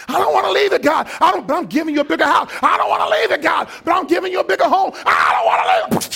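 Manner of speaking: high, loud pleading back and forth; hammed-up believer refusing to leave; clingy whine. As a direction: whine then answer quick and loud. Believer clinging, God answering.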